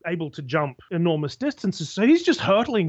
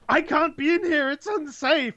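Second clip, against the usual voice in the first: high-pitched panicking mine owner; mock freak-out; 11 semitones higher than usual. Trapped mine owner finds himself underground, mock panicked. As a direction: imitate a rich man in a comic panic. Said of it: "the mine owner is freaking out".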